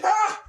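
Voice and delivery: high loud frustrated yell; angry yell. Retold or imitated. Raging as the Barstool flies off screen, high yell. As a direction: yell in fury. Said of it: "I was like".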